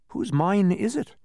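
high, loud, slow question; asking. A narrator's reading. Milo asking his guide about the mine, high curious question. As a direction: ask it with open curiosity. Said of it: "asked Milo".

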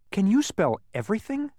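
put-on high-pitched, admiring boy; admiring question. A narrator's reading. Milo asks the winning bee, admiringly. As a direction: ask in admiration. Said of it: "asked Milo admiringly".

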